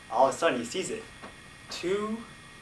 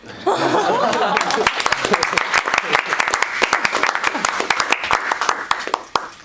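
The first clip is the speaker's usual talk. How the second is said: laughing; 12 semitones higher, 6 dB louder than usual. Real, not acted. Laughing at the story.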